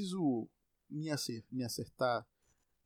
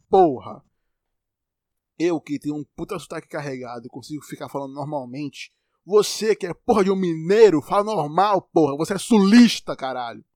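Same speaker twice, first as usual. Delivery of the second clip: furious loud swearing, pitched up; angry yelling; 2 semitones higher, 13 dB louder than usual. Real, not acted. Telling the southerner to talk normally, shouting angrily. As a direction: yell it at full force.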